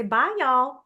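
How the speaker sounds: cheerful Southern twang on goodbye; Southern accent. Retold or imitated. Ending a Nortel phone call in a Southern accent. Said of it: "I would say".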